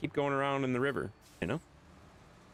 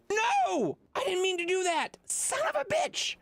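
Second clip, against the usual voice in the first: high yelps of dismay, much higher pitch; real shocked outburst. Real, not acted. Streamer blowing a move in the game, real shocked yelling. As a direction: yell out at the mistake.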